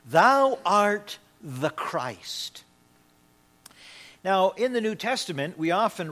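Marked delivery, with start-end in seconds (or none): 0.0-2.6 each word stretched out with weight; slow, solemn declaration. Acted. Preacher repeating Simon Peter's answer, slow and solemn. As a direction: proclaim it very slowly, word by word.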